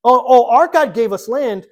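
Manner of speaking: loud, high-pitched, drawn-out taunt; mock taunting. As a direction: crow it slowly and loudly at a rival, play-acting. Acted taunt to a rival king.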